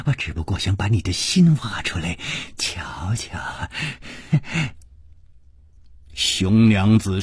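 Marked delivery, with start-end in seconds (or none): 0.1-3.7 crazed sweetness. A narrator's reading. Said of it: "只听宫南燕痴痴地说".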